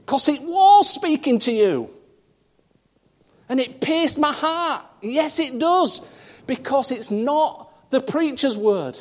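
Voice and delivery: fervent pulpit delivery, full of conviction; preaching. Acted. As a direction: preach it out to the congregation. Preacher building to climax.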